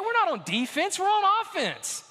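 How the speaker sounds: full-force sermon delivery; preaching. Acted. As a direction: preach it out, rising and fervent.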